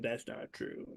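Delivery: breathy mocking whisper of a denial; mock team denial. Podcaster mocking the team's denial, whispered. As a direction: hush it out like a sheepish official denial. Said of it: "the team trying to be like".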